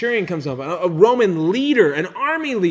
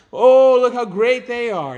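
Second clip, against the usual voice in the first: slow, louder, gushing praise; mock admiration; 5 semitones higher, 6 dB louder than usual. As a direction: ham up the fawning crowd. Crowd praising show-offs.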